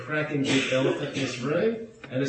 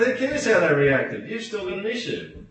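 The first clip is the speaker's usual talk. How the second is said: mildly exasperated retort, higher pitched; retold exasperation; 8 semitones higher than usual. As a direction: push back in disbelief at the student. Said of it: "I was going".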